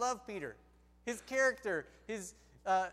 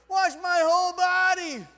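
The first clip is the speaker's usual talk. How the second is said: high, hammed-up shout; over-eager Peter; 9 semitones higher, 14 dB louder than usual. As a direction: shout it with comic overeagerness. Peter overreacts to foot washing.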